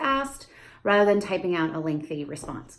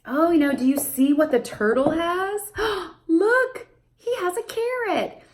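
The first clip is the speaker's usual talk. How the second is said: very high gasping excitement; high thrilled parentese; excited discovery; 8 semitones higher than usual. Shown as a demonstration. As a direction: show amazement to the child. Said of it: "you talk about it".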